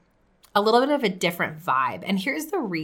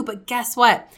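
ordinary talk, nothing marked

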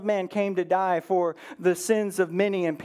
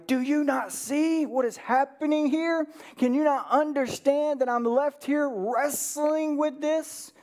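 exasperated plea to God; 6 semitones higher than usual. Acted. Preacher voicing her own complaint to God.

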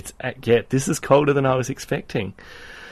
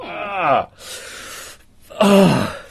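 pained groan; 6 semitones higher than usual. Real, not acted. Hand in ice water.

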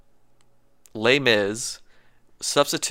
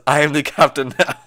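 louder mock captain voice; captain impression; 4 semitones higher, 7 dB louder than usual. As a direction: bark it like a ship captain. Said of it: "he says".

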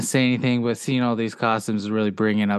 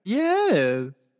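high put-on voice; 8 semitones higher than usual. Dad in Wonder Woman voice.

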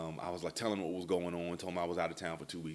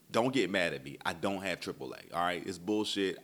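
ordinary delivery

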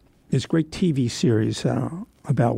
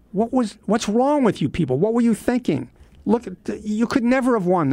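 high, pitched-up mock yell at the prisoners; retold yelling; 8 semitones higher than usual. GI on the truck yelling at captured Germans, mock shout. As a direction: call out the defeated soldiers, scornfully. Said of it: "started yelling at the Germans".

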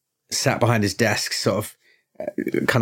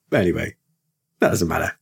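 normal talking voice

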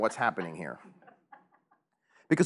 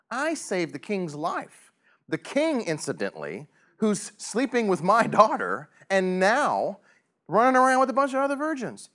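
indignant Mordecai; 7 semitones higher than usual. Pretend. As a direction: play the wronged man complaining.

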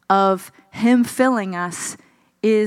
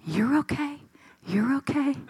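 softer, higher soothing voice; soft, higher, tender reassurance to a scared child; tender comfort; 5 semitones higher, 6 dB quieter than usual. Retold or imitated. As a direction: reassure gently like a loving father. Voicing God's prophetic comfort.

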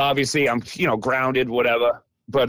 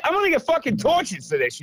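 fuming dread; 9 semitones higher than usual. Retold or imitated.

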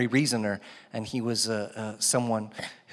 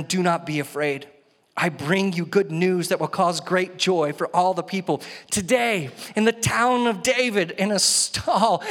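raised, emphatic Bible reading for a congregation; proclaiming scripture; 5 semitones higher, 3 dB louder than usual. Acted. Preacher reads the angel's good news to the congregation, proclaiming. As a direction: read it out as a rousing announcement.

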